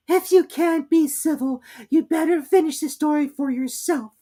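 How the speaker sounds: high squeaky mouse, sulking; sulky Dormouse. A narrator's reading. Dormouse sulking at Alice's interruption. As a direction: pout it out, offended. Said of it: "the Dormouse sulkily remarked".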